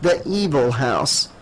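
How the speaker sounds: ordinary delivery, nothing marked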